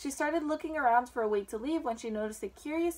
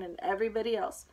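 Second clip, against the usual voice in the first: ordinary delivery, nothing marked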